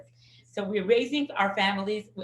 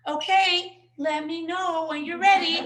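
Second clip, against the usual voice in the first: sweet patient mother; 6 semitones higher, 6 dB louder than usual. Retold or imitated. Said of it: "she's telling him".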